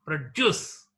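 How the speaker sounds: produce as pro-DUCE; second-syllable stress. Shown as a demonstration. Teacher repeating the verb, produce stressed on second syllable. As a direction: punch the last syllable of produce. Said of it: "The stress is marked on the second syllable".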